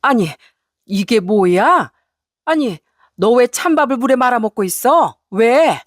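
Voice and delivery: appalled scolding, stretched disbelief; mother-in-law's anger. Acted. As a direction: demand why, angrily acted. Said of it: "어찌나 화를 크게 냈는지". (시어머니의 버럭 화내는 말투)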